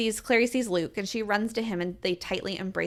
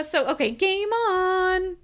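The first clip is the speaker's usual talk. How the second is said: high, slow, drawn-out hype; hyped announcer; 10 semitones higher, 3 dB louder, much slower than usual. Real, not acted. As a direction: build excitement like a host.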